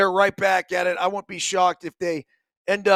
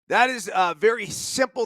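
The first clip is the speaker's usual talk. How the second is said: ordinary talk, nothing marked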